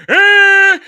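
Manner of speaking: high, loud hammed-up operatic shriek; loud mock shriek; mock singing wail. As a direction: belt it like a bad diva. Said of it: "and Disa went".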